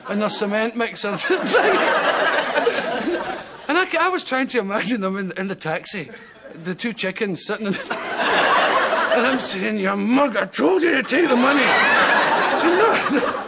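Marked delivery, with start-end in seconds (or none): 1.2-3.6 high giggle. Real, not acted. Laughing at cement mixer prize. 9.8-13.4 laughing. Retold or imitated. Scolding the chickens, laughing.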